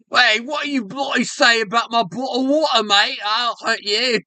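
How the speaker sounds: high-pitched mock British threat; angry British bloke. Imagined British neighbour threatening them, mock British accent. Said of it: "some British people knocking on our doors".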